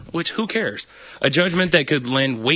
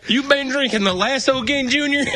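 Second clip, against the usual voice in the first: mock hillbilly mom; 11 semitones higher than usual. Playing a hillbilly parent.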